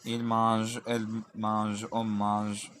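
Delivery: usual speaking voice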